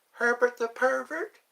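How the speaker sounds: high cartoon character voice; Herbert the Pervert. Naming another Family Guy character, high impression. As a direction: pitch it way up in imitation. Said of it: "does a good Herbert the Pervert".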